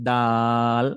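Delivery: "দাল" held on one note, clearly closed; even recited "দাল"; clear, long "দাল". Shown as a demonstration. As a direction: release the d, stretch aa, touch l in "দাল". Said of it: "তাহলে উচ্চারণটা একদম clear".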